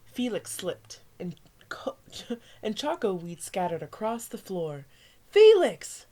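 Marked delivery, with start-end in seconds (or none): 5.3-6.1 sharp, much louder and higher cry of the name; yelling mom. A narrator's reading. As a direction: mom scolding with a shout. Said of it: "yelled his mom".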